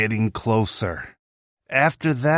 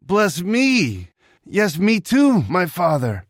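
higher, mildly pleading bitter cry; 8 semitones higher, slower than usual. Acted. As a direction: beg the father, not full wailing. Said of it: "cry out in an extremely loud and bitter manner".

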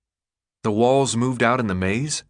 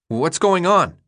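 raised, higher-pitched urgent question; alarmed question; 7 semitones higher, 4 dB louder, slower than usual. A narrator's reading. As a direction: ask it with a touch of alarm. Alarmed Thomas asks amid siren.

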